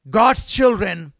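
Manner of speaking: slow shout. Acted. Preacher rallying the congregation, slow loud shout.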